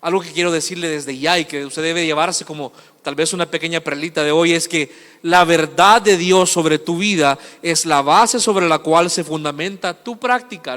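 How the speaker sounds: normal talking voice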